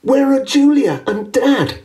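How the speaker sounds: worried puppy cry. A narrator's reading. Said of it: "Pup-Up cried".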